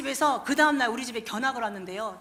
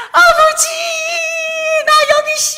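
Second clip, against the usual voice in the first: high, loud, excited cry to the Father; joyful call to Father; 12 dB louder than usual. Acted. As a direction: call out as you run home to him. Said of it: "하고 지금 벌써 막 달려갑니다". (아버지께 달려가며 기뻐 외치는 목소리)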